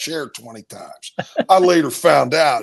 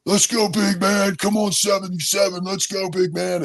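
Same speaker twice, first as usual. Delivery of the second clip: mock yell of encouragement, pitched up; imitated teammates yelling; 8 semitones higher than usual. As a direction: imitate teammates urging someone on from afar. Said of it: "I could hear teammates yelling".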